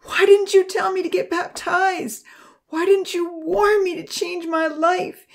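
high, loud, tearful accusation; anguished outburst, rising to a high wail; bitter sobbing. A narrator's reading. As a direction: hurl the blame through bitter sobs, pitch climbing toward despair. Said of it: "she sobbed bitterly".

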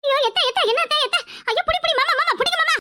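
high, panicked calls to break up the fight; panicked cry for help. Acted. Woman begging uncle to stop the brawl, panicked high cries. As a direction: shriek for them to grab him.

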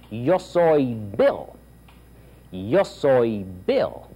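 very slow, clear repeat-after-me; slow classroom drill voice; teacher drill. Shown as a demonstration. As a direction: model it slowly for learners to repeat.

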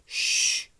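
long, insistent "shh"; loud shush. A narrator's reading. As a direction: hush a noisy tiger. Child hushing tiger in closet.

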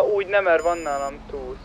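ordinary talk

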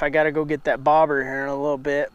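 ordinary talk

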